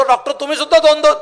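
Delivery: put-on high, loud drunken yell at the doctor; retold shout. Drunk friend shouts at the doctor that he sees double, loudly. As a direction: blurt it out loudly, seeing double. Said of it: "हा ओरडतो".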